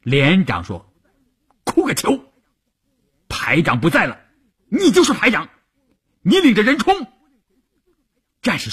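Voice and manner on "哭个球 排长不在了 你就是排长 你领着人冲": high-pitched, forceful order; commander's raised, impatient voice; rough rebuke. A narrator's reading. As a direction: shut him up and order the charge. Company commander rebukes weeping soldier.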